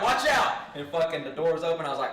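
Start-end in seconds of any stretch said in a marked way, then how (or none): 0.0-0.5 loud, high-pitched alert; reenacted urgent yell; warning shout. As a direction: yell a warning across the room. Retold warning to friend.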